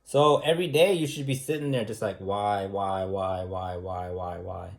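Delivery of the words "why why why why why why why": fading repeated whys. Pretend. Inner questioning acted out.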